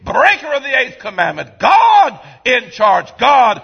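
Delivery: loud, high shouted preaching; full-force preaching. Real, not acted. Preacher pounding the eighth commandment, loud and high. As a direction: drive it home to the pews.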